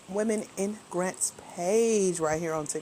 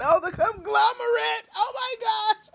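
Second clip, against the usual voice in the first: high squealing laugh; 11 semitones higher, 5 dB louder than usual. Real, not acted. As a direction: laugh through the words. Reacts to a viewer name.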